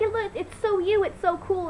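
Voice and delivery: high, excited imitation of classmates gushing. Schoolmates praising her new blue hair, high and gushing. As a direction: chirp it like impressed schoolmates. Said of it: "They're like".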